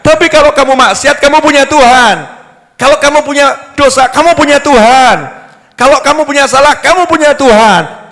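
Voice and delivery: high, loud, rousing repetition; fiery preaching. Acted. Preacher rousing the congregation, full-force preaching. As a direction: hammer home that God is there for them.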